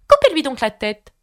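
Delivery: mild mock royal command. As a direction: snap out the order. Duchess orders beheading.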